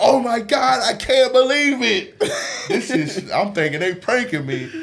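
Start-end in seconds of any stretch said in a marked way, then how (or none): 0.0-2.2 high, loud, laughing squeal; thrilled kids. Retold or imitated. Kids outside at the court, high thrilled squeal. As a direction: gush like kids who can't believe it. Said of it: "They were like".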